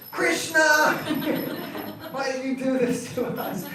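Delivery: high, loud laughing cry of his name; playful high laughing accusation; laughing reproach. Retold or imitated. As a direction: scold him through laughter. Gopis discover Krishna, laughing.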